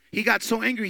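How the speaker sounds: loud, high, fast preaching; preaching at full force. Acted. Preacher urging the suffering church, full-force shout.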